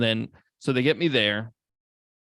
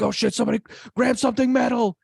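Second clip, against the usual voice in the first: high, fast panicked shout; panicked toys; 11 semitones higher, 3 dB louder, faster than usual. Retold or imitated. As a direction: squeal it in a rush like toys in a panic. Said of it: "they're like".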